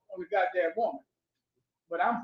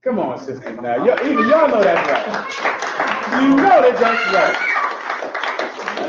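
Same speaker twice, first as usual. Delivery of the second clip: loud, rousing pulpit preaching; full-force preaching; 2 semitones higher, 10 dB louder than usual. Acted. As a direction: whip up the congregation. Preacher working up congregation.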